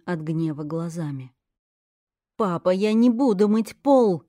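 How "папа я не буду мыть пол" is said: loud, louder shout ending on пол. Acted. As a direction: yell the refusal at him. Girl shouts at father.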